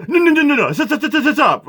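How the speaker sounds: high, rapid stammered repeats; mocking mimicry. Imitating the pastor cutting the woman off, mocking. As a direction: parody the pastor's flustered interrupting. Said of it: "here's a, here's a".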